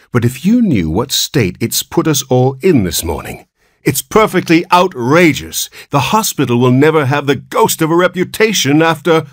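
high, rising indignant protest; outraged scolding. A narrator's reading. Nurse scolds Mister Button over the hospital's reputation, outraged. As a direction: build to a high-pitched outburst of outrage.

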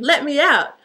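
a lightly acted bellow, pitch lifted; mock bellow. A narrator's reading. Trapped Cinderella demanding release in a story, mild bellow. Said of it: "She bellowed".

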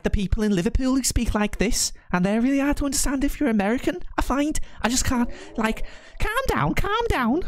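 very high-pitched exaggerated Scouse; attempted Scouse accent. Shown as a demonstration. Streamer's best attempt at Liverpool speech, high hammed-up Scouse accent. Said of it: "my best attempt at a Scouse accent".

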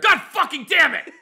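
high laughing curse; frustrated yell. Pretend. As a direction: blurt it out, half laughing.

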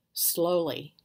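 usual speaking voice

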